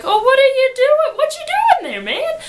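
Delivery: high, loud frightened outcry; panicked cry. Retold or imitated. Janie cries out in fear as her sick lover attacks her, high and loud. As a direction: yell in alarm as he lunges at you.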